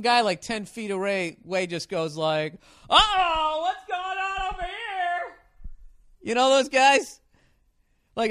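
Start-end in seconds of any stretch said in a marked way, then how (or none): 2.9-5.4 high, slow, booming sportscaster call; mock sports announcer voice; loud announcer. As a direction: call it out across the bar like a broadcaster. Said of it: "The fucking announcer".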